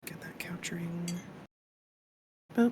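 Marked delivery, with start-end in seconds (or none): 0.1-1.1 unvoiced whisper under the breath. Real, not acted. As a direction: mutter it in a whisper. Whispering while fixing the stream.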